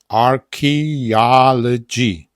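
Archaeology stretched out, loud, stress on al; Archaeology syllable by syllable, loud; very slow exaggerated demo. Shown as a demonstration. Teacher modelling the word slowly for students. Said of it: "Ja bym to tak powiedział".

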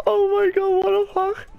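high and loud, beside himself; can't believe his luck; elated shriek. Real, not acted. Still elated.

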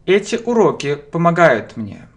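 ordinary talk

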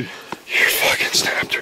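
breathy whisper of "You fucking snapped her", no voice; mock-grave whispered accusation. Real, not acted. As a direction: hush it like a secret. Repeats line, then whispers it.

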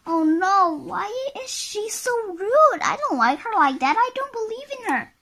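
high, rapid outraged commentary; dismayed onlooker. Acted. Onlooker reacts to bossy character's rudeness, high and dismayed. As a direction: gasp out disbelief in a high little voice.

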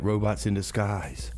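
ordinary delivery

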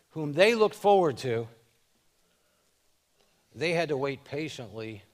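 rising loud preaching climax; preaching build-up. Acted. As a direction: build the sermon to a loud peak.

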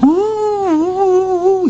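a woman's high hooting reply from the cave; answering call. Acted.

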